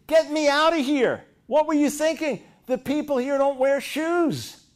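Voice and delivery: high, loud panicked cry of a stranded salesman; hammed-up frantic plea to be pulled out.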